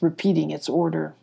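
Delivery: plain reading voice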